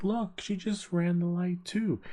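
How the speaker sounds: very high, drawn-out complaint; mock whiny protest. Cyclist points out another offender to officer, high mock protest. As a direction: act out tattling on someone, slowly and high.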